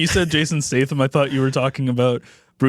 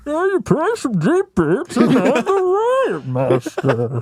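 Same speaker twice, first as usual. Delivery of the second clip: high, slow, laughing mock villain voice; 10 semitones higher, much slower than usual. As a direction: ham up a pompous movie villain, slowly. Parodying a movie villain.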